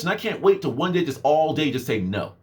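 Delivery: usual speaking voice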